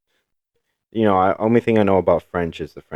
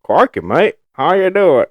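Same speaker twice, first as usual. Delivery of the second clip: put-on lightly done Australian accent, a bit higher and louder; cheerful Australian accent; 9 semitones higher, 5 dB louder than usual. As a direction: greet like an Aussie bloke. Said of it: "go Australian".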